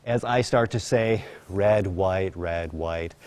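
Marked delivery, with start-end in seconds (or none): 1.5-3.1 calm the horse down with your voice; slower, relaxed cue. Shown as a demonstration. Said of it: "I'm saying it more slowly and more relaxed".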